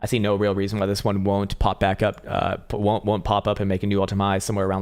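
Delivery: ordinary talk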